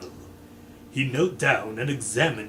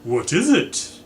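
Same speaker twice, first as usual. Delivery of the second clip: alarmed question; 5 semitones higher, 6 dB louder than usual. Acted. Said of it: "cried Mister Body".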